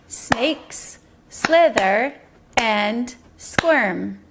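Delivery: "Snakes slither and squirm" very slow, on separate beats; syllable clapping. Shown as a demonstration. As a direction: pause between words, a beat on every syllable of "Snakes slither and squirm". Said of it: "Those are our syllables".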